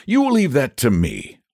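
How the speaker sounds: high-pitched, assured promise; confident. A narrator's reading. Saint George takes charge of the aim, confidently. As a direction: take charge with easy certainty. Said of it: "said Saint George with confidence".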